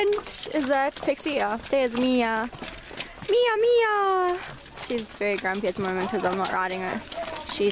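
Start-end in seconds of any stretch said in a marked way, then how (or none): 3.3-4.4 high, bright sing-song call to the mare; calling a horse. Real, not acted. Calling sweetly to her grumpy mare. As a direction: coo her name up high.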